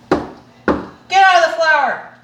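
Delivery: high, drawn-out yell at the dog. Real, not acted. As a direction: shout the dog off the flour. Scolding dog in the flour.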